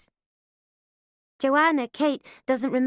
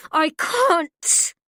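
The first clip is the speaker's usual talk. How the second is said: louder, higher cry; anguished cry; 5 semitones higher, 5 dB louder than usual. A narrator's reading. Mother crying out mid-struggle, anguished. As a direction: break out with it.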